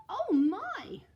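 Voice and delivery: gasp, then a high loud oh my; sudden gasp. Acted. Mum realising the pie is forgotten, gasping high and loud. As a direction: catch your breath in surprise.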